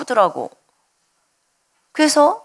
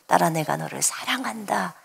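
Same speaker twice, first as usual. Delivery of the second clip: soft tender voice; 5 semitones lower, 7 dB quieter than usual. Retold or imitated. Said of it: "이렇게 저한테 처음으로 들려왔어요".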